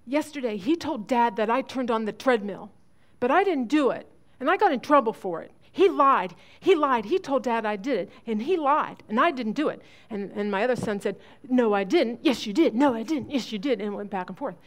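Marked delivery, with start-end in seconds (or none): 0.0-9.8 put-on high, loud tattling kid voice; an indignant boy telling on his brother; complaining child. 11.5-13.8 loud little back-and-forth squabble; two brothers bickering over who did it; bickering kids. Retold or imitated. Voiced sibling back-and-forth.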